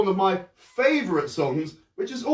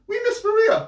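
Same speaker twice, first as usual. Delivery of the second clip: put-on children's voices; 3 dB louder than usual. Children voiced pleading to Von Trapp.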